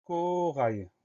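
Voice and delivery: high, held "corail", falling at the end; dictation word. Shown as a demonstration. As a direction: stretch "corail" slowly for pupils to spell. Teacher announces word for spelling.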